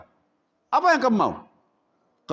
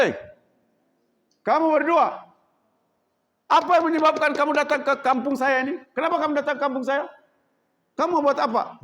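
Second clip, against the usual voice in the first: loud, high angry challenge to strangers; angry chief; 7 semitones higher, 3 dB louder, faster than usual. Acted. Chief confronting two strangers, angry high shout. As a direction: bark at the two intruders.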